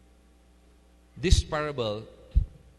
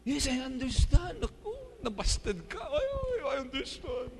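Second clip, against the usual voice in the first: mock sympathy; 13 semitones higher than usual. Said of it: "mock sympathy to the servant".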